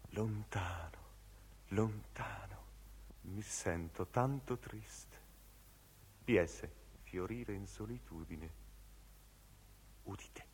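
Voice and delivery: soft, breath-like, fading melancholy; distant sadness. Acted. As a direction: let the words drift away sadly. Distant, sad passage of recitation. (triste, sommesso, lontano)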